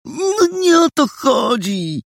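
high, drawn-out exasperated protest; peeved whine; indignant bristling. A narrator's reading. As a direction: protest slowly, in a huffy high voice. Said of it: "żachnął się Boguś".

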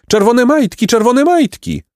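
very high, thrilled chant; hammed-up high-voiced spectators; excited cry. A narrator's reading. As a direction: squeal the sighting out twice. Maidens spotting runner in race.

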